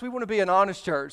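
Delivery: ordinary talk, nothing marked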